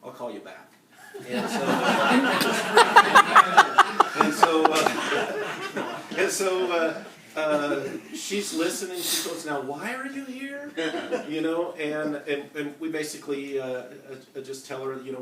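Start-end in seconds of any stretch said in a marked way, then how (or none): 4.2-6.0 big, loud burst of real laughter; hearty laugh. Real, not acted. Preacher cracking up at his own story, loud laughing. 9.4-10.7 lightly voiced woman asking, pitched well up; quizzical neighbor. Preacher imitating the woman at the door, high questioning voice.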